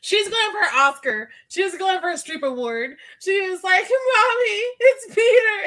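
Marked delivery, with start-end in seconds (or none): 3.8-5.6 imitating a weeping girl, much higher and louder; mock weeping. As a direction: wail it like a child faking tears. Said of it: "And she's weeping".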